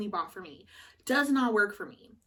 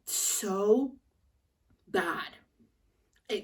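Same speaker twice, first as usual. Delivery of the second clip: breathy whisper; much slower than usual. Real, not acted. Longing for a donut.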